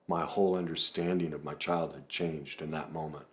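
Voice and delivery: ordinary talk, nothing marked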